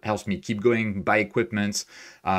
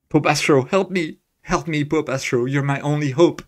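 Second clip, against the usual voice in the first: higher, hammed-up cry for help; theatrical, half-joking begging; mock desperate plea; 6 semitones higher, 4 dB louder than usual. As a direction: plead playfully for rescue. Mock plea quoting a film line.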